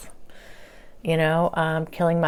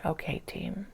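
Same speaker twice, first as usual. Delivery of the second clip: hushed okay; 10 dB quieter than usual. Retold or imitated. Quiet reply to spirit team.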